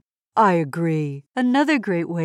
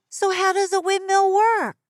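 put-on broad singsong kids theatre; 12 semitones higher, 3 dB louder than usual. Curious kid character asking.

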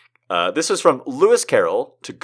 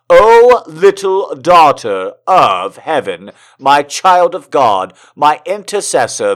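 louder, swelling, slow and rapturous declarations; impassioned love letter reading; 3 semitones higher, 8 dB louder, slower than usual. Acted. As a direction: pour out each endearment like a lovesick suitor. Reads Tolstoy love letter.

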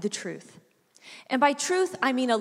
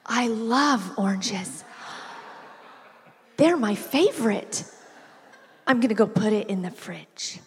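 put-on toddler voice. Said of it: "She goes".

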